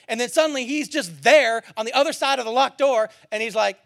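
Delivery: normal talking voice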